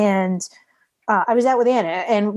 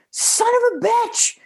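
high, slow burst of a curse; startled curse; slower than usual. Retold or imitated. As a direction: blurt it out in shock, drawn out. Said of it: "he jumped really loud".

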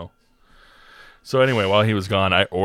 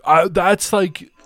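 indignant friend; 5 semitones higher, 3 dB louder than usual. Retold or imitated. Friend lecturing the narrator on paying etiquette, high indignant whine. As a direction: explain etiquette like it's obvious.

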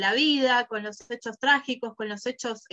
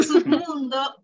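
laughing; 3 semitones higher, 5 dB louder, slower than usual. Real, not acted. Laughs about her dad's word.

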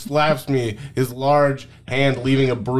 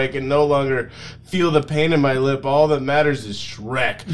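ordinary delivery

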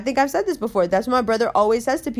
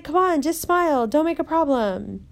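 higher coaxing voice; placating girl; 6 semitones higher than usual. Retold or imitated. Girl dragging Umber over, coaxing her in a higher voice. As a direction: urge her to just smile, pitched up. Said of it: "She was like".

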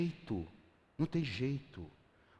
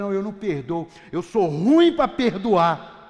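mocking stubborn voice; 11 dB louder, slower than usual. Mocking an unforgiving believer.

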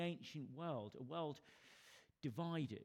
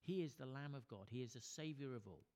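usual speaking voice

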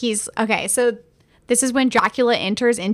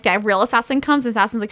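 normal talking voice

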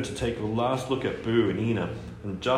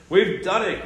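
higher, louder cry of triumph; excited cry; 9 semitones higher, 6 dB louder than usual. Acted. Bobby cheering the breakthrough to family, excited cry. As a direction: burst out with joy. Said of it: "cried Bobby".

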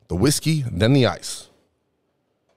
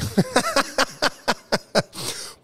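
high laugh; 3 dB quieter than usual. Real, not acted. Laughing at own joke.

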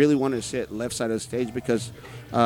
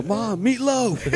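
high, drawn-out call to mom; mock yell; 11 semitones higher, 3 dB louder, much slower than usual. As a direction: holler for meatloaf like a demanding kid, stretched out. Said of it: "I just have to yell".

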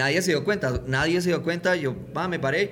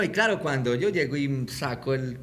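usual speaking voice

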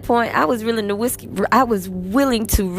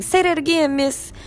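put-on much higher kid voice asking for more; imitating eager kids; 11 semitones higher than usual. Students wanting the accent again, high mock kid voice. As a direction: mimic a delighted student begging.

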